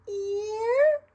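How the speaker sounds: high, loud pageant queen voice; poised pageant answer. Shown as a demonstration. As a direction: sing it out like a beauty queen. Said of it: "this is the beauty queen voices".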